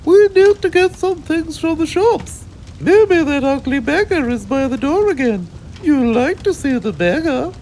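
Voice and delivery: very high put-on posh mother voice; high falsetto mum; Mummy's voice. A narrator's reading. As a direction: squeal it out like a fussy mummy. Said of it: "said Mummy".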